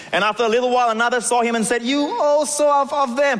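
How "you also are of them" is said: slow, high accusation. Acted. Bystander accusing Peter.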